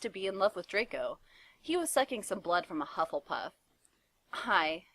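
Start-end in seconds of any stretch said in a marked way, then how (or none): none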